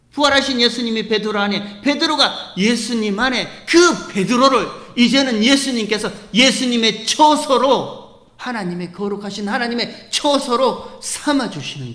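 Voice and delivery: loud, ringing sermon climb; full-force preaching. Acted. Pastor calling out to the congregation, full-force preaching. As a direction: preach it out with full conviction to the church.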